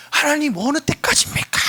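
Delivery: high, loud anguished cry to God. Acted. A believer crying out to God, high and loud. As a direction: wail the question upward. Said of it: "막 이렇게 탄식을 한단 말이에요".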